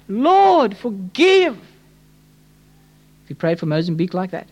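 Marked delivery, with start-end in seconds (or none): 0.1-1.5 high, heartfelt cry for mercy; pleading prayer. Acted. Preacher praying for people under judgment, pleading aloud. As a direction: call up to God, voice raised and falling on each phrase. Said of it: "praying for the people under judgment".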